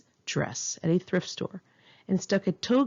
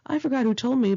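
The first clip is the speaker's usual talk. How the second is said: ordinary delivery, nothing marked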